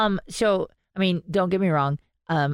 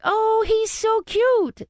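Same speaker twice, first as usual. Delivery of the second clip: much higher, slow cooing gush; gushing over the bear; slower than usual. Retold or imitated. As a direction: coo like over a puppy. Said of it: "she was like".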